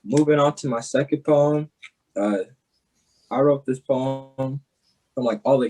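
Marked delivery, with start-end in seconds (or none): none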